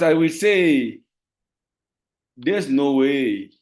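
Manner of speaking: usual speaking voice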